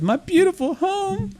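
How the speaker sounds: a heartbroken duck crying over its home; wailing duck. Acted. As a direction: duck mourning the polluted lake, high and slow. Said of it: "Duck says".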